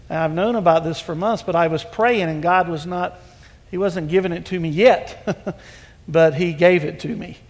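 usual speaking voice

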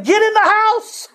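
put-on high, slow, eager old man; excited order. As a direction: drag it out in a hurry to welcome him. Old man inviting him in.

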